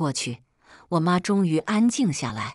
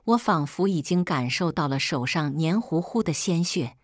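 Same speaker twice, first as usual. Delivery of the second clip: ordinary delivery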